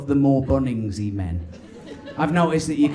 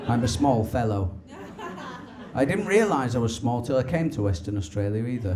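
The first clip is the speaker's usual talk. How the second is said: normal talking voice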